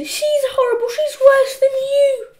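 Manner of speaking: put-on high, tearful little-boy complaint; desperate wail. A narrator's reading. Peter wails about Margaret.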